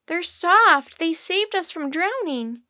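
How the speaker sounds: high-pitched earnest objection; crying out. A narrator's reading. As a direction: plead the case loudly. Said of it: "cried Annie".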